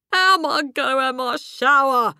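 high, loud indignant demand. A narrator's reading. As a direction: demand an answer in exasperation. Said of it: "he demanded".

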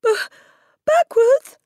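put-on very high, loud, panicky little boy; stammering. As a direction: stammer it as a terrified schoolboy, voice shooting up. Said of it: "stammered Wilfred".

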